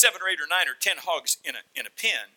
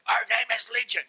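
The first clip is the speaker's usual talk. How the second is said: raised, rough demon voice; higher-pitched voice of many demons; 8 semitones higher than usual. Acted. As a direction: answer as a possessed man. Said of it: "what did he sound like?".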